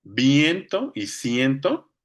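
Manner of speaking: viento y ciento, slow with long stressed E; VIEN-to y CIEN-to, spelled out slowly. Shown as a demonstration. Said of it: "el acento está en la última, en la E".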